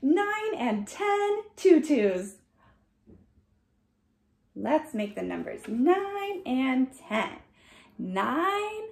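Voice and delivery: ordinary delivery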